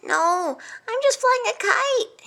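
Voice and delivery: high-pitched, rising objection; protesting. A narrator's reading. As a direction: protest to someone who has misunderstood. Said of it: "Hamlet protested".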